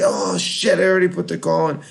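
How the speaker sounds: fast, breathless half-whispered oh shit; retold panicked rush. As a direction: gabble it like he just realized his mistake. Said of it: "He's like".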